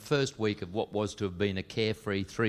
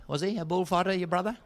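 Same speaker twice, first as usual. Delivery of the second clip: higher, puzzled prodding; pressing question; 6 semitones higher than usual. Acted. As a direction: ask again, higher and nosy.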